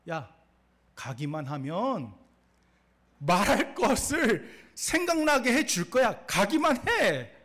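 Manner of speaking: slow, insistent promise building to a loud push; God's emphatic urging. Acted. Preacher as God urging Moses to go, building to loud insistence.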